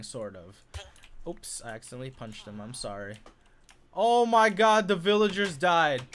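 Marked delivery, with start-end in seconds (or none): 3.9-6.0 loud, high dismay; loud cry of dismay; shocked outburst. Real, not acted. Finds villagers dead.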